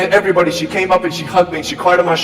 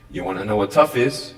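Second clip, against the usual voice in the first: the repeat, said low and sincere; low earnest question; 10 semitones lower than usual. Acted. Speaker asks the students again, low and earnest. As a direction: drop the pitch well down and ask it gently. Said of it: "repeated question, lower second take".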